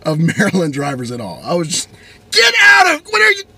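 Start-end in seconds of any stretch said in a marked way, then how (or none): 2.3-3.4 shrill, very high, loud yelling; furious high-pitched road-rage yell; mad shout. Retold or imitated. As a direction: scream at him in a rage. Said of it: "Just mad at this guy".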